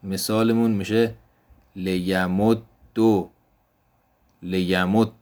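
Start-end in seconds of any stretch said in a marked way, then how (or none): 1.8-2.6 لیمدد said as "liyamuddu", doubled d ending in u; لیمدد as lesson demo, "li-ya-mud-du"; Arabic doubled dal with damma. Shown as a demonstration. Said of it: "ضمه بدیم و ادغام کنیم". 4.4-5.1 لیمدد said as "liyamuddu", doubled d ending in u; لیمدد as lesson demo, "li-ya-mud-du"; Arabic doubled dal with damma. Shown as a demonstration. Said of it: "ضمه بدیم و ادغام کنیم".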